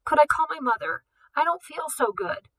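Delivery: put-on boy faking tears to the director; shaky, near tears. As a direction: quaver like a scared kid. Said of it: "his voice shaking so violently".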